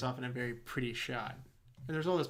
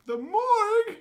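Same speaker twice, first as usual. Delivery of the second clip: very high, loud mock-hysterical husband; hammed-up shriek; 9 dB louder, slower than usual. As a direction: squeal it in fake horror, laughing at the end. Husband reacts to morgue news.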